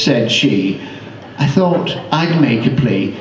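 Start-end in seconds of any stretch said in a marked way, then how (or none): none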